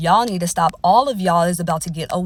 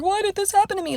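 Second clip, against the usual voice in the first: much higher, whiny mock wail; mock whiny crying; 12 semitones higher, faster than usual. Karma victim whining to the universe, pitched way up. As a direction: imitate someone whining at karma. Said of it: "you over here crying like".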